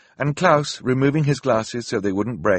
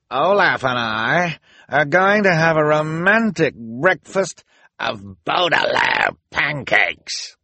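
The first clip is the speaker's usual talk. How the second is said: high, slow, loud mocking villain voice; gloating villainess; 7 semitones higher, 5 dB louder, slower than usual. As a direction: savor each word like a cruel joke. Villain Esme gloating mid-chase.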